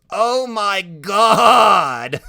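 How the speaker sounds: high, loud, drawn-out exclamation; whooping shriek. A narrator's reading. Freak cracking up at his friend's answer, shrieking loudly. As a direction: whoop it up in hilarity. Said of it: "he's shrieking and laughing and whooping it up".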